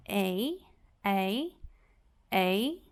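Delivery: "ei" drawn out as the long A sound; long A said aloud. Shown as a demonstration. Teacher showing students the long A sound, drawn out. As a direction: say "ei" slowly, like the letter's name. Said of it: "a letra A é que vai falar o próprio nome".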